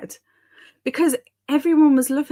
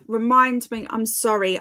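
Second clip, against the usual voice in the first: ordinary talk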